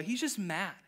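ordinary talk, nothing marked

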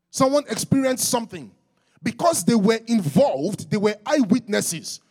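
high, loud pulpit delivery; full-force preaching. Real, not acted. Preacher explaining testimony to the church, high, loud preaching.

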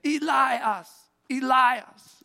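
"Elias" said ee-LIE-us, pitched high; period pronunciation. Shown as a demonstration. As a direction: say "Elias" with stressed LIE and an "us" ending. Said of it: "which is how they would have pronounced".

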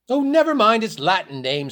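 high, loud panicky outburst; screamed. A narrator's reading. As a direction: cut in with a mild scream. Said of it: "screamed Mavis".

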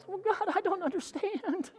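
high, small, pitiful whine; puny whining voice. Acted. Preacher mocking his own complaints to God, puny whine. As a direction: whimper it feebly in a pinched high pitch. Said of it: "That puny voice".

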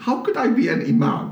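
mock-baffled, rushing the question out; incredulous protest. Retold baffled protest to lady.

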